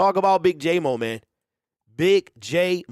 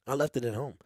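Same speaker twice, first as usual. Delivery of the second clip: softer, deflated "I left it at home"; rueful repeat; 5 semitones lower, 9 dB quieter, faster than usual. Real, not acted. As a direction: let "I left it at home" drop soft and sorry. Forgot GoPro, repeats it.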